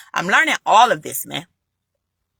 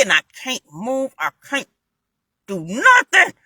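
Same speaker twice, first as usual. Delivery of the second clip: retold struggle; 4 semitones higher than usual. Retelling being paralyzed, struggling to move.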